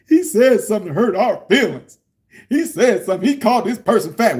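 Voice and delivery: high whiny complaint; mocking whiny voice. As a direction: mimic the whiners. Mocking Trump critics.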